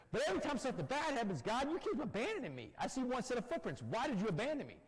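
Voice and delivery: high, fast, anguished complaint to God; desperate plea. Retold or imitated. Man on the beach accusing God of abandoning him, high desperate plea. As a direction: cry out feeling abandoned.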